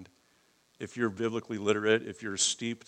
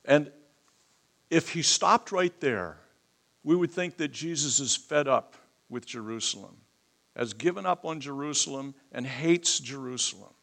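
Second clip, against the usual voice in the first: higher, earnest preaching voice; raised preaching; 6 semitones higher, 6 dB louder than usual. Real, not acted. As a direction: keep the fire as you explain.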